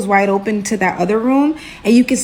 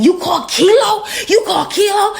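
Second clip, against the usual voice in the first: high, incredulous mock holler; imitated scream; 10 semitones higher than usual. Friend screaming in the background, high mock yell. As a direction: holler it up high as if from another room. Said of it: "screaming in the background saying".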